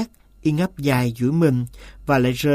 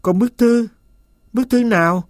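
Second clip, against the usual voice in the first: high-pitched, anxious narrated demand; urgent questioning; 6 semitones higher than usual. A narrator's reading. Master demanding news of his letter, urgent questioning. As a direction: press for an answer, pitched up.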